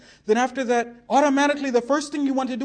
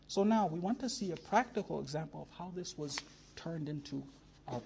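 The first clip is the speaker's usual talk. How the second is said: much softer, intimate sermon narration; hushed storytelling; 9 semitones lower, 14 dB quieter than usual. Acted. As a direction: draw them in gently, almost confiding. Invites listeners into the Karbala scene.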